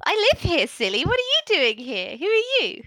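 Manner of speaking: put-on high, teasing little girl; a child calling the stranger silly; orc child voice. Orc child questioning the hiding adventurer, high and teasing.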